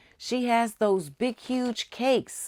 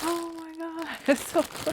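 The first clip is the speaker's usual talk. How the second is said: breathy laughing; 10 semitones higher, 5 dB quieter, faster than usual. Real, not acted. Opening yarn, overjoyed.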